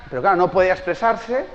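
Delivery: usual speaking voice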